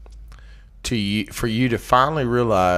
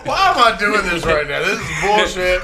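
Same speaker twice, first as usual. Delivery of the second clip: laughing; 7 semitones higher, 3 dB louder than usual. Real, not acted. Fumbling with microphone, laughing.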